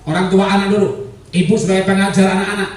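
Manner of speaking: ordinary talk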